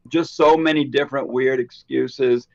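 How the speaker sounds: ordinary talk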